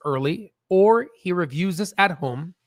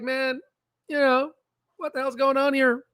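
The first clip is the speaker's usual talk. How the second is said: high-pitched mock exasperation; upset patient; 7 semitones higher than usual. Patient fed up with the doctor, upset. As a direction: act out getting worked up, pitch raised, not shouting. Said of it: "you just start getting upset".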